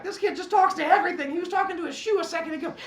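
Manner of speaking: high, loud, fast tattling with a laugh; exasperated classmates. Retold or imitated. Kids telling the teacher about the chatty kid, high loud tattling. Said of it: "they're all like".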